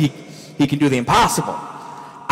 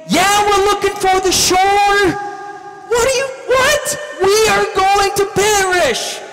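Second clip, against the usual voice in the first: acted hysterical shouting; frantic yelling; 14 semitones higher than usual. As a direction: voice pitched way up in panic. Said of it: "they're probably like".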